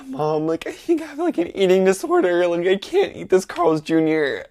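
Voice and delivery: high-pitched mock-panicked plea; acted high, anxious confession; freaking out. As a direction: whine to mom in exaggerated alarm. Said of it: "I'm freaking out".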